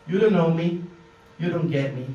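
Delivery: ordinary delivery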